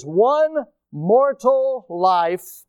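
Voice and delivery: "mortal" slow and deliberately weighted; slow right down to set "mortal life" apart; stress on MORTAL. Real, not acted. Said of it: "I put the emphasis on mortal life".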